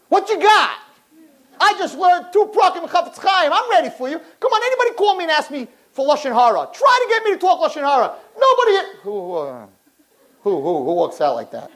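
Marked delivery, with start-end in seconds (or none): 0.1-8.8 high, fired-up challenge; mock defiance. Learner takes on the Yetzer Hara, fired-up mock defiance. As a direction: play someone pumped up and daring all comers. Acted challenge to the evil inclination.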